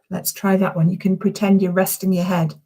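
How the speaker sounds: usual speaking voice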